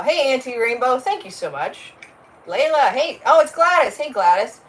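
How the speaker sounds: ordinary talk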